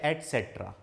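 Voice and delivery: एटसेटरा repeated as "et-setra", with a "t"; correct pronunciation. Shown as a demonstration. Teacher repeating the right form for students, एटसेटरा pronounced "et-setra". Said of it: "जो सही pronunciation है इसका".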